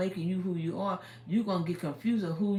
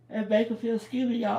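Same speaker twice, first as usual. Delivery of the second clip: words stretched through a yawn; real yawn swallowing the words; 5 semitones higher, 7 dB louder, faster than usual. Real, not acted. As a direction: say it mid-yawn. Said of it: "I always yawn".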